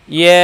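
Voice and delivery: ইয়া as a light yae, vowel held; mid-tongue light ya. Shown as a demonstration. Teacher shows learner the proper way, light fronted ইয়া. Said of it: "জিহ্বার মধ্যখান ওপরে তালুর সাথে".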